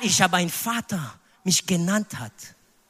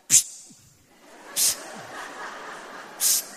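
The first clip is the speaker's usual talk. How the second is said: short hissed "psst", with gaps between; wordless hissed summons; psst attention call; 9 dB quieter than usual. Shown as a demonstration. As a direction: hiss for a nameless person's attention. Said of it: "wir sagen".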